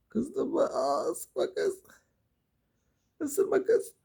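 very high, squeaky teasing; playful pet talk. Real, not acted. Owner teasing an angry biting pet, high squeal.

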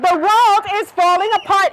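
high-pitched alarmed rabbit; panicked cry. A narrator's reading. Frightened rabbit warning the world, panicked cry. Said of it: "she started to scream".

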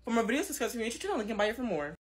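rapid soft channel plug; fast quiet outro. Acted. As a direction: rush through the outro.

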